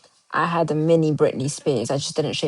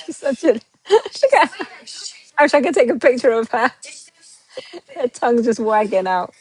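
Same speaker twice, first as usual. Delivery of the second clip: doting pet talk; 7 semitones higher, 5 dB louder than usual. Real, not acted. Cooing over her dog on stream.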